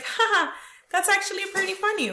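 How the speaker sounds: high, quick mock laugh of surprise; spoken ha-ha, then high amused disbelief; acted amused shock. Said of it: "very shocked".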